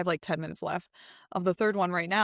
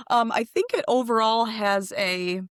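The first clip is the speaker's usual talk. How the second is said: ordinary talk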